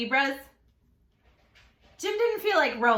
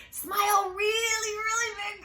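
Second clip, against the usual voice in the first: cheering shout; 10 semitones higher than usual. Real, not acted. Teacher urging viewers to smile, high excited shout. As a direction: shout it with a grin.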